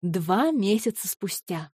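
ordinary delivery

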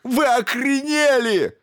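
high, loud, slow outraged shout; furious yell. A narrator's reading. Stevens yells at the crew in fury, high loud. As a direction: scream at them in outrage.

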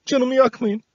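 high, frightened pleading cry; crying out in fear. A narrator's reading. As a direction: beg not to be hurt. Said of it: "diye haykırınca".

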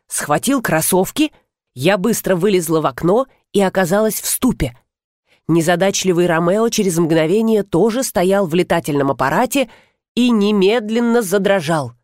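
ordinary delivery, nothing marked

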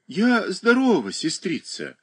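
lightly voiced sad child; gloomy child. A narrator's reading. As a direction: answer like a listless little girl. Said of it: "как-то уныло ответила Даша".

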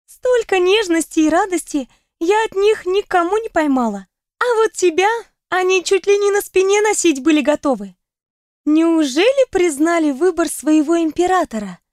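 весёлый пьяненький голос; рассуждать навеселе; захмелевшая подруга. Acted. (голос захмелевшей подруги)

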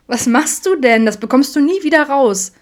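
amused cry at a sauce-splattered shirt; laughing out loud. A narrator's reading. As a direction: tease him between bursts of laughter. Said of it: "Vincent lachte ausgelassen".

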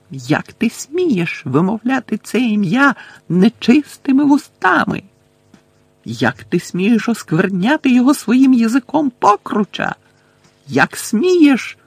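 slow, shrill-high outraged tirade; high-pitched rage. Acted. Bellatrix rages at Harry for saying the name, high and slow. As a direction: spit out every word in fury.

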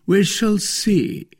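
slow, grand, high-pitched pronouncement; majestic. A narrator's reading. Porthos answers the wife's plea, majestic. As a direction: keep them waiting, loftily drawn out. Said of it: "said Porthos majestically".

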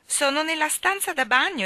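high-pitched theatrical despair; tragic tone. A narrator's reading. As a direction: exclaim it as if it were a tragedy. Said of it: "esclamò con tono tragico".

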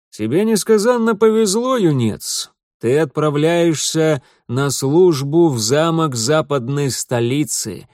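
loud, gruff-sounding captain; ship captain. Acted. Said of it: "сказал ему капитан судна".

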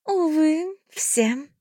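high, sighing fairy voice; sad fairy sigh. A narrator's reading. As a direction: sigh it out sadly. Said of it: "печально вздохнула она".

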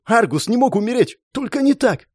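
high, climbing cry of disbelief; anguished denial. A narrator's reading. Hero hears friend is dead, anguished high denial. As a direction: refuse the news, pitch soaring.

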